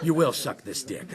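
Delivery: low, gruff Liam Neeson-style menace through laughter; Taken villain parody. Acted. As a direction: growl it like an action hero threat. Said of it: "picturing the next Taken movie".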